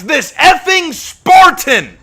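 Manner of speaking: high, loud, drawn-out cry of outrage; exasperated outburst. Real, not acted. Ranting to fellow players about the knights, exasperated outburst. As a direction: stretch it out in loud, high-pitched indignation.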